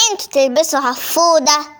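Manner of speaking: ordinary talk, nothing marked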